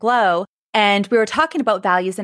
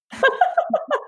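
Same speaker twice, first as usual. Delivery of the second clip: bright burst of laughter. Real, not acted. Laughing at Burning Man memory.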